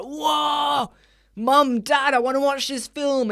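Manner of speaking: put-on high, eager kid begging mum and dad; excited kid. Kid begging mum and dad to see the film, excited. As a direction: pester your parents like an excited child.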